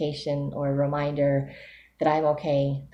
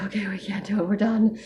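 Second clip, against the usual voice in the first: defeatist inner voice; 6 semitones higher than usual. Retold or imitated. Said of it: "my emotional side is like".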